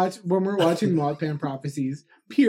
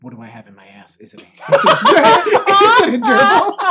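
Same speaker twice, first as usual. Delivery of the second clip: laughing mock question; 6 semitones higher, 11 dB louder than usual. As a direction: ask it deadpan, then crack up laughing. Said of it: "I look at Michelle and I go".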